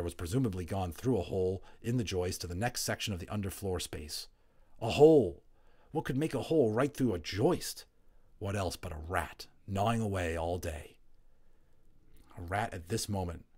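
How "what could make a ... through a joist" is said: usual speaking voice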